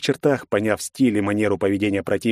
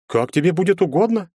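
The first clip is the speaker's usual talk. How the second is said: a mild scornful snort on угодно, higher; 8 semitones higher than usual. A narrator's reading. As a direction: toss off «угодно» with contempt. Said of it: "фыркнул тот". (с фырканьем, презрительно)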